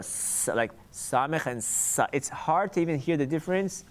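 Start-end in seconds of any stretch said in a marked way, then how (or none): 0.0-0.5 sa, breathed out unvoiced; whispered sa. Shown as a demonstration. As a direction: hiss the sa without voice. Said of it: "like more lower".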